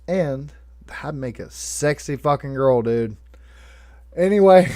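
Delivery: ordinary talk, nothing marked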